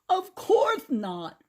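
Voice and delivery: put-on high, slow, lisping old man voice; toothless Grandpa. A narrator's reading. Grandpa mourns his stolen teeth, high toothless lisp. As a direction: lisp through a mouth with no teeth.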